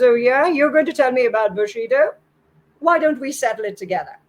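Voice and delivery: scoff, then dare him to fight; aggressive, incredulous comeback; scornful challenge. A narrator's reading. Samurai scoffs and challenges Bokuden.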